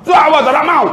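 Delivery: high mock child sulk; child refusing. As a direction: refuse the food like a sulky little boy.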